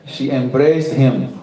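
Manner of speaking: ordinary delivery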